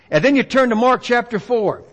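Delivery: ordinary talk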